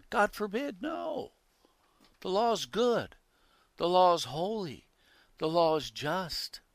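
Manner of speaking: high, loud pulpit insistence; emphatic preaching. Real, not acted. Preacher defending the law to the congregation, emphatic preaching.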